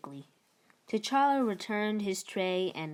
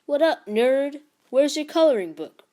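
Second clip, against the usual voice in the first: put-on higher, louder sneering teen voice; bully taunting Zeke, higher and louder; 8 semitones higher, 11 dB louder than usual. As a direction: jeer at the small nerdy kid. Tall boy taunts Zeke.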